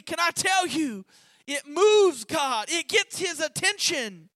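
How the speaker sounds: loud, emphatic sermon declaration; fervent preacher proclaiming; preaching. Acted. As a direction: drive the point home to the church. Preaching at full force.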